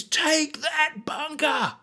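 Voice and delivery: high, slow battlefield shout; screamed order. Retold or imitated. Tom Hanks screams the order at his men. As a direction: bark the order across the field. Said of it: "he just screams".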